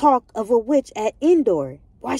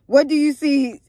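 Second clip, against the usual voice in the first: Saul's voice; 4 semitones higher, 4 dB louder than usual. Acted. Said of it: "my bad, hold on".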